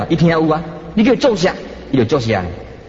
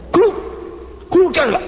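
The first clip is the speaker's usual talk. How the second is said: short, high, sharp call to a dog; serious, abrupt command voice; stern dog command; 13 semitones higher than usual. Shown as a demonstration. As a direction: demonstrate scolding a dog by its name. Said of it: "你会发觉较严肃讲".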